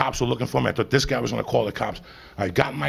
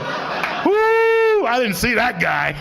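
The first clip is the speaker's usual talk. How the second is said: very high, loud yelling whoop; giddy shout; 13 semitones higher, 5 dB louder, much slower than usual. Acted. Stoned driver yelling after near-miss, giddy shout. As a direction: holler it out the car window.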